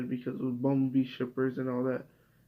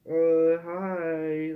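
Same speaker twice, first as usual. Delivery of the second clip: deadpan mock greeting; 3 semitones higher, 4 dB louder than usual. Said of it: "like, fucking Volume seven".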